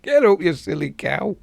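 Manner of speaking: high laughing scold. Real, not acted. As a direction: tease her while laughing. Laughing at the character.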